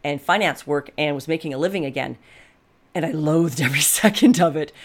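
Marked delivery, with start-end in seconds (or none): 2.9-4.7 laughing. Real, not acted. Loathed her old work.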